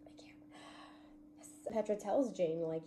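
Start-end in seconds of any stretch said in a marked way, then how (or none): 0.1-1.5 breathless whisper of disbelief; hushed can't. Retold or imitated. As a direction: barely voice it, stunned. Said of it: "And I was like".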